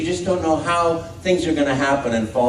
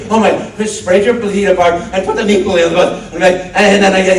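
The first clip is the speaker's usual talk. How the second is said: mock shaking panic; 5 semitones higher, 6 dB louder than usual. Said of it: "and my whole body is shaking".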